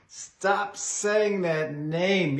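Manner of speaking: slow, drawn-out demonic voice. Retold or imitated. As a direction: drag each word out like a demon. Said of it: "the demons said".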